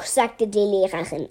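usual speaking voice

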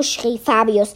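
ordinary delivery, nothing marked

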